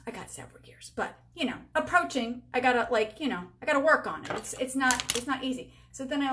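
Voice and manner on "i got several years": whispered, "I got several years." said under breath; whispered aside. Real, not acted. Vlogger whispering to viewers that she is not fifty yet, breathy aside. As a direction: hush it as a secret.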